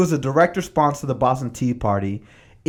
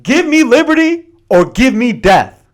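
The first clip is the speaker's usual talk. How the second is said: much higher, louder orator's proclamation; Patrick Henry's rallying cry; 8 semitones higher, 9 dB louder than usual. Retold or imitated. Patrick Henry at the Virginia Convention: loud rousing proclamation. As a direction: declaim it like a fiery speech. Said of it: "the most famous part of the speech is".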